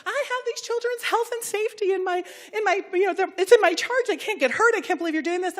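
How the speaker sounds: high, anxious fretting mimicry; panicked teacher. Teachers in the corner fret to her, high anxious mimicry. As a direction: imitate a worried teacher fretting.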